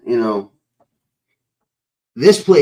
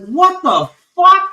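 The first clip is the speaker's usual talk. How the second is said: high, loud, slow mock disbelief; hammed-up shocked exclamation, stretched out; retold disbelief; 7 dB louder, slower than usual. Retold shock at swastika find.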